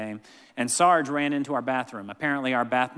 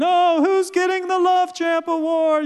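put-on excited kids; 8 dB louder than usual. Kids excitedly turning to Katie.

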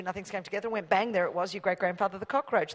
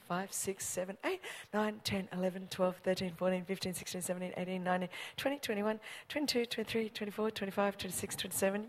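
quiet head count; 9 dB quieter than usual. Shown as a demonstration. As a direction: count the crowd off softly, steady.

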